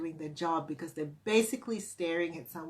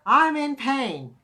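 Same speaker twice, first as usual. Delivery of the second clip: slow, loud, higher mock protest; voicing body's complaint; 6 semitones higher, 8 dB louder, slower than usual. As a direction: play the body insisting, drawn out and loud. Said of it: "my body kept saying to me".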